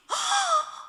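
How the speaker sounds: sharp high gasp of shock; retold gasp. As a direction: gasp as if seeing the navel gone. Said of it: "하더니요". (깜짝 놀라 헉 하는 소리)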